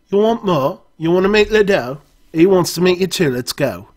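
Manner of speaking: high, loud Cockney voice; Cockney accent. Acted. No-Nose urging the player to meet Ledoux, loud Cockney. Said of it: "He has a Cockney accent".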